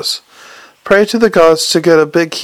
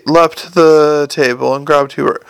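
ordinary delivery, nothing marked

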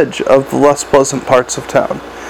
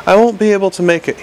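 usual speaking voice

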